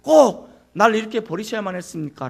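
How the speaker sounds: loud, desperate cry of protest; anguished protest. Acted. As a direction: cry out as if abandoned by the Father. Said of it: "라고 항변하는 거예요".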